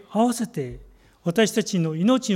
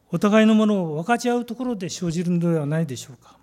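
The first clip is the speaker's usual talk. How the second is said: normal talking voice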